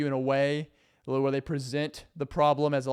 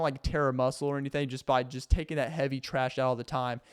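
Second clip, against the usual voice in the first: normal talking voice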